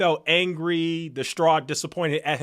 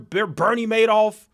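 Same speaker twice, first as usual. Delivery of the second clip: ordinary delivery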